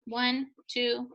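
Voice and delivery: normal talking voice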